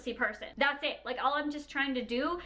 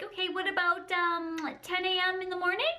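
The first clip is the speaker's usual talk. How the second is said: higher, questioning appointment voice; imitating appointment booker; 6 semitones higher than usual. Receptionist offering an appointment time, higher polite questioning voice. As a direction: lift it into a tentative polite question.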